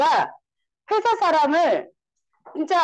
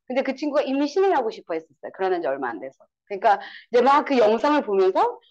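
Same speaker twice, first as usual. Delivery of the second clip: ordinary delivery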